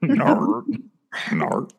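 croaky mock Australian "Nar"; frog troll. Friend mocking the failed Australian no, frog troll voice. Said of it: "It sounded like a frog".

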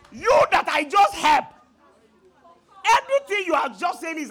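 loud indignant scolding; angry rebuke. Retold or imitated. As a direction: shout him down in outrage. Rebuking ungrateful brother, retold.

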